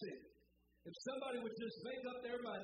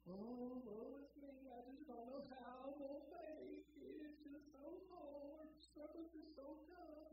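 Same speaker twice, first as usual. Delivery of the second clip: softer, self-pitying whine, a parody of complaint; mock moaning; 3 semitones higher, 10 dB quieter than usual. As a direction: sigh it out like a hammed-up sufferer. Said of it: "all you do is moan".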